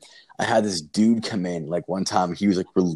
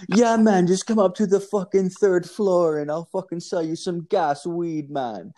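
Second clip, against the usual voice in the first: very high, loud mock Jamaican voice; Jamaican accent; 10 semitones higher, 3 dB louder than usual. Dealer inviting him up to the third floor, high Jamaican voice. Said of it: "It's, like, a Jamaican dude".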